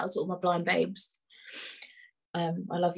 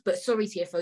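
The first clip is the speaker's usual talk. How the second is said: usual speaking voice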